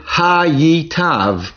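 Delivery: "hayitav" drawn out, ha-yit-tav with doubled yud; slow careful pronunciation. Shown as a demonstration. Teacher models the word slowly for the class, careful and clear. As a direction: spell "hayitav" out slowly, syllable by syllable. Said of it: "is pronounced hayitav".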